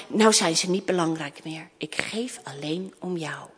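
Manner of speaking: low, hushed confession; tender. Acted. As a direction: sink softly into heartfelt affection. Princess tenderly reassures her father. (teder en zacht)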